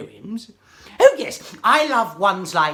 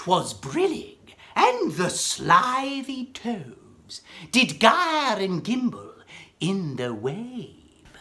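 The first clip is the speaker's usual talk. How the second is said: dramatic poem reading; 4 semitones lower than usual. Acted. Reciting Jabberwocky, dramatic.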